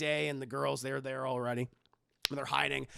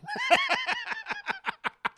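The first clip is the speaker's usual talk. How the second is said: long high-pitched cackle; laughing. Real, not acted. Cracking up at own Madea impression, laughing. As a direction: crack up completely.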